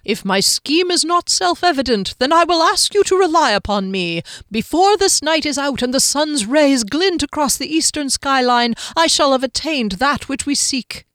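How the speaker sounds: halfling orating to his companions; grand halfling oration. A narrator's reading. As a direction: proclaim it like a grand promise. Skimnoddle's oration.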